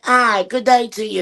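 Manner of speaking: high, slow, prim "good day" greeting; mock British greeting. Guest imitating a friendly British stranger, high and slow. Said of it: "they're like".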